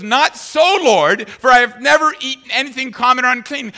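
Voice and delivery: high, loud protesting Peter; Peter arguing. Retold or imitated. Preacher voicing Peter arguing with God, high and loud. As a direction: argue back with God. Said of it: "He goes".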